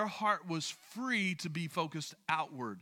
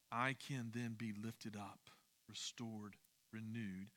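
softer and lower, gently reassuring; tender reassurance; 6 semitones lower, 9 dB quieter than usual. Real, not acted. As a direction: ease the words down like a comfort. Promise of restoration to weary believers.

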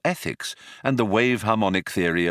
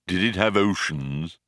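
gloomy robot; 2 semitones lower than usual. Acted. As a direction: flatten all wonder as a depressed robot. Marvin asks drearily.

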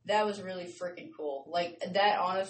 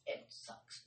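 whispering; 16 dB quieter than usual. Real, not acted. Tired aside after complaint.

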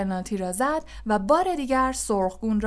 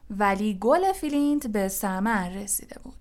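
ordinary delivery, nothing marked

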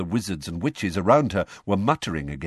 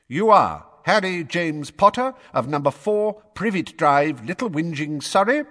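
pompous official; 8 semitones higher, 3 dB louder than usual. A narrator's reading. Fudge reads the charges.

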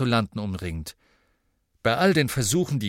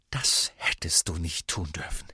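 whisper; 6 semitones lower than usual. Acted. Hermine scolds in secret.

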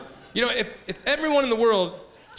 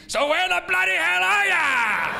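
higher, slow, in-your-face slogan shout; aggressive slogan; 8 semitones higher, 3 dB louder, slower than usual. Retold or imitated. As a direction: bark the slogan at the viewer. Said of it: "come off just a tiny bit aggressive".

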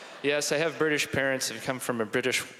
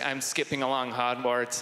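ordinary delivery

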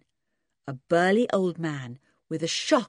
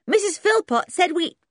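Anne's voice; 14 semitones higher, 6 dB louder than usual. Acted. Anne cut off by bark.

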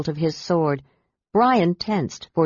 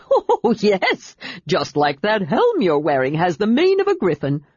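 overcome with laughter. A narrator's reading. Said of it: "so overcome with laughter".

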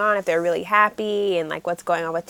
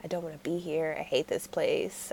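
lower, much softer sulky grumble; glum mutter; 4 semitones lower, 10 dB quieter than usual. Retold or imitated. Tae walking home, low and sulky. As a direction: mumble it dejectedly. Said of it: "He's like".

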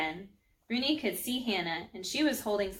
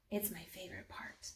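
whisper; 15 dB quieter than usual. Acted. Mari shows Rooney the best part.

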